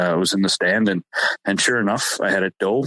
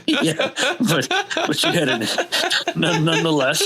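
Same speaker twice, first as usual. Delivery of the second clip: laughing; 8 semitones higher than usual. Real, not acted. Laughing at host's English accent joke.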